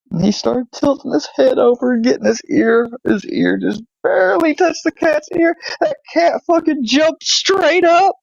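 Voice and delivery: high-pitched, animated retelling; excited storytelling. Retold or imitated. Excited retelling of cat's jump.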